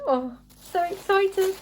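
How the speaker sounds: high-pitched squeal of excitement; excited. Real, not acted. Unwrapping a long-awaited journal, giddily excited. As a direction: let real excitement lift the voice right up.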